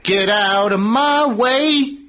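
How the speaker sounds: normal talking voice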